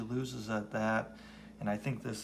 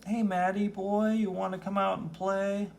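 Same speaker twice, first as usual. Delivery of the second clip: higher sing-song bully voice; taunting kid; 10 semitones higher, 4 dB louder than usual. Acted. Neighborhood bully taunting young Matt, high mocking voice. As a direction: tease him like a schoolyard bully.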